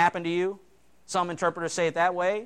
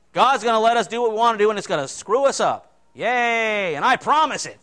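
sarcastic cheer; 5 semitones higher, 6 dB louder than usual. Acted. Sarcastic cheer about promise.

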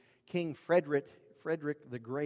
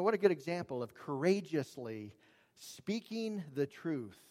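usual speaking voice

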